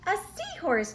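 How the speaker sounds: high, loud delighted cry of discovery; excited cry. Acted. Pete finds the seahorse on his tail, excited cry. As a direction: cry it out, thrilled at last. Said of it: "cries Pete".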